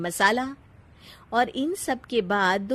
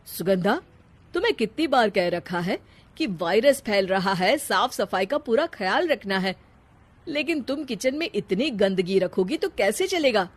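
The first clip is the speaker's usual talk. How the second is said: scolding husband; 4 dB louder than usual. Acted. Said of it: "डांट कर कहता है".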